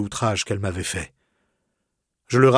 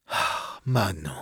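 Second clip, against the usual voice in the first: soft sighing "Ah, Manon", much softer; 4 semitones lower, 8 dB quieter, slower than usual. Acted. The wounded lover sighs Manon's name to her. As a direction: breathe out "Manon" on a sigh. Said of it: "repris-je avec un soupir".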